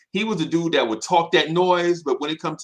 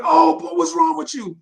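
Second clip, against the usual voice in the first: high, loud startled yelp; a hammed-up high cry of alarm; mock shocked cry; 7 dB louder than usual. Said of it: "He's like".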